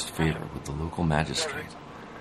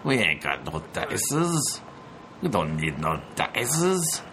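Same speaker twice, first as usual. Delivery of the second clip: put-on angry bandit Mexican accent; 7 semitones higher, slower than usual. Angry bandit, Treasure of Sierra Madre parody.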